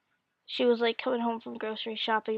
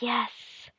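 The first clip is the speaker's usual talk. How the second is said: whispered cheer; 5 dB quieter than usual. Retold or imitated. Whispered celebration.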